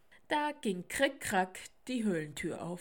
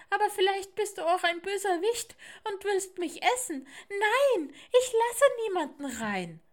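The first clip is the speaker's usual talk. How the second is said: put-on high, loud, alarmed child protest; defiant little owl; 12 semitones higher, 9 dB louder than usual. Little owl fears a villain will eat her, high loud defiance. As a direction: cry out a squeaky refusal. Owlet refuses, fearing a villain.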